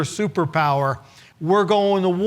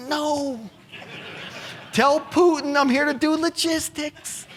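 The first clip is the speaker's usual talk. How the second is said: much higher panicked inner voice, the words squeaking out; 10 semitones higher than usual. Retold or imitated. The staffer panicking inside as Putin calls. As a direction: squeak with dread at the thought of taking the call.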